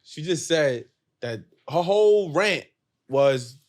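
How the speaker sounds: ordinary talk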